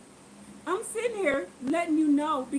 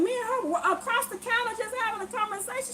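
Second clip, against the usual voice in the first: ordinary delivery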